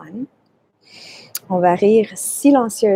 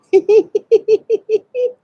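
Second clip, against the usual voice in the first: high laugh; 12 semitones higher, 7 dB louder than usual. Shown as a demonstration. Laughter yoga session.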